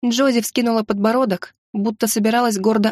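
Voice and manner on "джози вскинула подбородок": ordinary delivery, nothing marked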